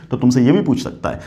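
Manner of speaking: ordinary delivery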